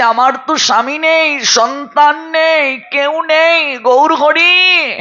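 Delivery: high, slow, wailing lament; acted wail climbing to a long-held cry; tearful maidservant. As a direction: plead high and drawn out, imitating a weeping servant. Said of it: "দাসীটি কেঁদে কেঁদে বলছে".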